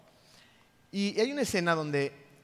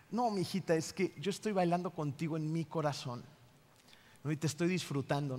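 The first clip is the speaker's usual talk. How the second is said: softer, gentle fatherly reply; tender father; 5 dB quieter than usual. Retold or imitated. Police officer tenderly answering his dancing daughter, soft and gentle. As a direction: soothe your little girl lovingly.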